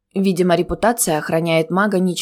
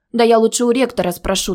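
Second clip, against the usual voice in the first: ordinary delivery, nothing marked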